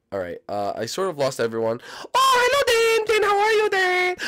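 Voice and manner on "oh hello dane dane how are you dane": much higher, louder singsong; a cheerful monster finding its prey; creepy animatronic greeting. Acted. Animatronic finding Y K, high singsong.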